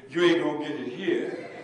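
loud, dismissive brush-off; curt waitress. Retold or imitated. Waitress turns the preacher away, loud and dismissive. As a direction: wave him off like a waitress would. Waitress refuses to serve him.

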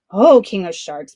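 put-on boy jeering at the king with his stolen lobsters; taunting call. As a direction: tease him, lay it on loud. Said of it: "Punia taunted".

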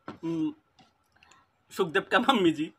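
ordinary talk, nothing marked

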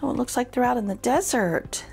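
plain reading voice

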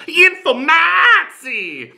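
"Informatie" very high, A hugely stretched; exaggerated Dutch "Informatie", slow and soaring; mock Dutch pronunciation. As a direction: shriek out "Informatie" with a long "maaa". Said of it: "how they pronounce information".

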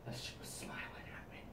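eerie whisper. Acted. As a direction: breathe it out creepy. Whispered creepy detail.

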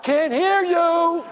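heckling yell. Retold or imitated. Steve heckling the actors, a slow mocking yell. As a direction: stretch it out like a heckler in the theater. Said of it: "he even yelled out".

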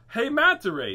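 loud, high call across the reef; cheerful hail. Acted. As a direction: call out to the manta ray. Turtle hailing manta ray.

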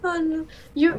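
ordinary talk

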